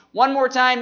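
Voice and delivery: ordinary talk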